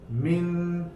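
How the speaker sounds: "Min" with short i, held n; reading demo. Shown as a demonstration. Teacher to class, modelling "Min". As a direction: say "Min" as one joined syllable. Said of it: "nuna bağla".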